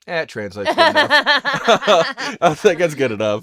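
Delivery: loud, laughing shrug it off. Retold or imitated. Telling his wife the Russian story, loud and laughing. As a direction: laugh through your own punchline. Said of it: "And I go".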